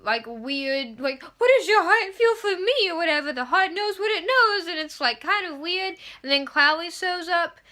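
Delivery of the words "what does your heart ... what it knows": a hint of soap opera drama, higher; lightly voiced soap opera lovers; mock soap opera. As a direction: ham it up like a daytime soap. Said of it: "like a soap opera".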